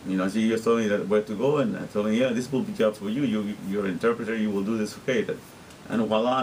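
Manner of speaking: ordinary talk, nothing marked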